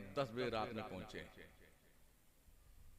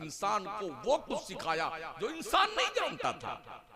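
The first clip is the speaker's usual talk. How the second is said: a preacher stirring the crowd from the pulpit; full-force Urdu sermon, swelling and falling; fiery sermon; 7 semitones higher, 15 dB louder than usual. Acted. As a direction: preach it loud and high, rising to shouts. Preaching to a gathering.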